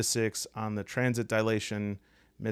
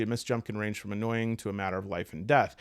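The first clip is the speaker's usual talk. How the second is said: ordinary talk, nothing marked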